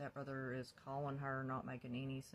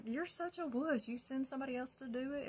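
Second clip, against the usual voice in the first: high, loud scornful jab; retold angry taunt; 10 semitones higher, 5 dB louder than usual. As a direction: call him out, pitch way up.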